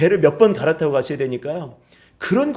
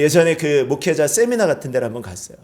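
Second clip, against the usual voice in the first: ordinary talk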